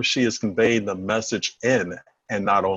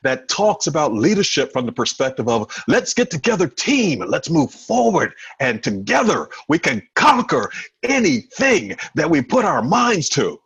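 high, energized motivational delivery, building to a rallying cry; rally the team with raised pitch and punch; exciting leader voice; 5 semitones higher, 3 dB louder than usual. Shown as a demonstration. Showing how a leader fires up the team, excited. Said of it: "someone that sounds exciting".

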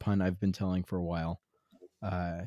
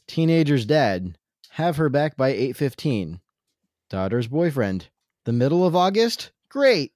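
louder joke read, rising to a high sarcastic "Great"; 4 semitones higher, 7 dB louder than usual. Acted. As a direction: read it like a comic bit.

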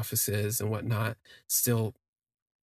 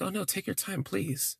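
softly voiced polite stranger, lifted pitch; gentle, higher-pitched courtesy, lightly acted; imitated courteous man; 8 semitones higher, 3 dB quieter than usual. Voices the sweet stranger being courteous.

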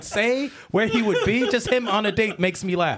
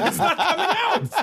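laughing; 10 semitones higher than usual. Pretend. Repeated higher, laughing.

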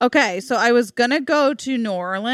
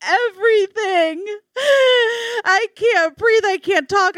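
very high, drawn-out mock wail; retold sobbing; 13 semitones higher than usual. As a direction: imitate a hysterical sobbing patient. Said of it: "I'm sobbing".

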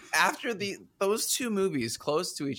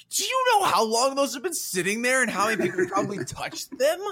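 incredulous shout; 9 semitones higher than usual. Retold or imitated. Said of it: "And I'm like".

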